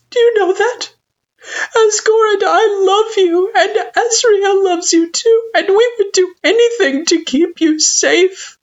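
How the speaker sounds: very high, loud, crying parent; tearful plea. A narrator's reading. Parent assures child the family loves them, tearfully. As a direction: plead with love through sobs, stretching words.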